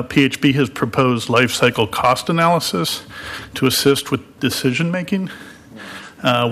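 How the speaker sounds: ordinary delivery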